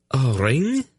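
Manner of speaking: mild, unsure suggestion; hesitant. Acted. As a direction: offer it timidly. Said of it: "Laldoran suggested hesitantly".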